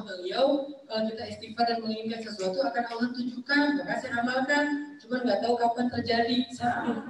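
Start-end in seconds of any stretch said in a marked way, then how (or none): none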